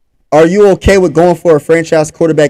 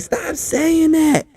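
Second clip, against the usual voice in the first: a mock of the wife, higher, slow and softer; 10 semitones higher, 8 dB quieter, much slower than usual. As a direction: play the annoyed wife in a higher, slower, softer voice. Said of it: "She was like".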